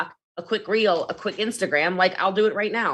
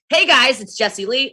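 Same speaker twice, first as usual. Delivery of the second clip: upbeat, louder and higher; high energy intro; 5 semitones higher, 4 dB louder than usual. Shown as a demonstration. Showing live viewers a high energy intro. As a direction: hook them right off the bat. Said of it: "high energy".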